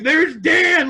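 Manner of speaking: high and loud pointing-out shout; excited shout. Retold or imitated. Imitating a wrestler recognizing a friend, excited shout.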